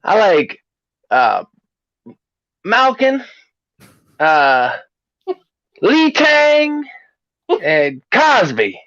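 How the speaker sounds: halting list with names pitched high; high, put-on sports fan voice; parade fan impression. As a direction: imitate a fan groping for names, then squealing them. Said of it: "he said".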